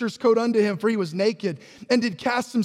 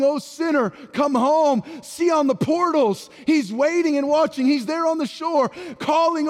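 raised, drawn-out preaching cry; 5 semitones higher than usual. Real, not acted. Preacher urging discouraged people that Jesus waits, preaching. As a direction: proclaim it with rising, insistent force.

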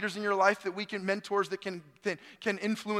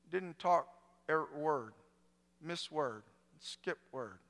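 halting impaired speech; 2 semitones lower, 7 dB quieter, slower than usual. Retold or imitated. Pastor shows how the ill man talked, halting and broken. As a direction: speak haltingly, long gaps between words. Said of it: "he talked like this".

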